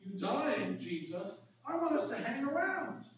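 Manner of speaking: a touch of excited pleading; excited Peter. Acted. Preacher as Peter wanting Jesus to hang around, mildly excited. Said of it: "in his excitement".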